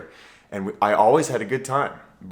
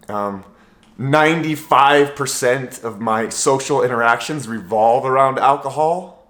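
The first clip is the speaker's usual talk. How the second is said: ordinary talk, nothing marked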